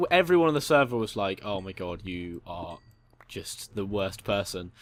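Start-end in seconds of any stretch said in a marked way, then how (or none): 1.4-4.7 softer, lower, slow disgusted verdict; deadpan scolding. Retold or imitated. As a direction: drop it flatly with disdain. Server players scolding prankster.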